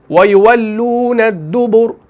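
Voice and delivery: दुबुर ending on a thick r, slow; heavy r at the stop. Shown as a demonstration. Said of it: "इस रॉ को हम मोटा कर रहे हैं".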